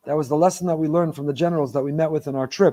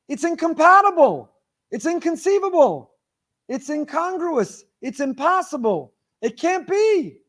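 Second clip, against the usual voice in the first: high-pitched, emphatic disbelief; incredulous protest; 10 semitones higher than usual. Retold or imitated. Rabbi giving Yitzchak's reason for asking, incredulous. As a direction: protest each point as flatly impossible, voice pitched way up. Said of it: "he asked it as a question".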